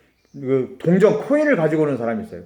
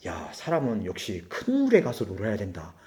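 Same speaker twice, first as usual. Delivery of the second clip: much softer and lower, half to himself; grumbling to himself; 10 semitones lower, 11 dB quieter than usual. Retold or imitated. Hurt bus driver to himself, soft bitter grumble. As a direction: grumble it under your breath.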